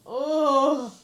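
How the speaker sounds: loud, high yelp of disgust; disgusted shout. Real, not acted. Reacting to friend's gross joke, loud disgusted yelp. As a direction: recoil out loud.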